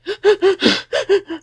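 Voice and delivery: high gasping sob for breath; sobbing gasp. Retold or imitated. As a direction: imitate a panicked crying gasp. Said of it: "like, that type of crying".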